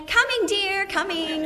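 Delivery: high, loud, mock-eager reply; hammed-up obliging husband, laughing at the end; eager answering call. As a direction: sing out as if rushing to dinner. Acted husband suddenly answering dinner call.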